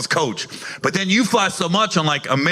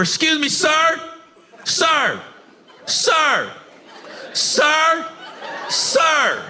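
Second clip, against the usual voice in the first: higher, whiny pleading lady voice; pleading woman passenger; 8 semitones higher, slower than usual. Retold or imitated. Woman asking him to switch seats, high mock pleading. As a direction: beg like a wife wanting her husband's seat.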